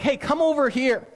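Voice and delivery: high, eager call to come over. Retold or imitated. King calling a servant over, eager high beckon. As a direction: beckon someone across the room, keen.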